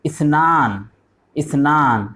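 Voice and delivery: اثنان repeated slowly with a falling close; Arabic drill repeat. Shown as a demonstration. Teacher drills Arabic two for pupils, slow model repeats. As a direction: model اثنان for the class to copy. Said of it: "دو کو بولتے ہیں اثنان".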